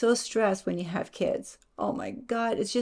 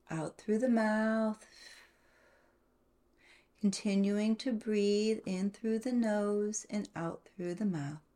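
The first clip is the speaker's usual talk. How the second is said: guided meditation; 6 dB quieter than usual. Acted.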